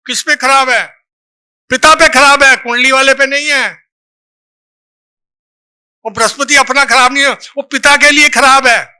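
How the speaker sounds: ordinary delivery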